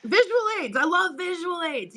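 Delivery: high, thrilled echo; excited. Real, not acted. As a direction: gush over the drawing. Echoing, thrilled about the drawing.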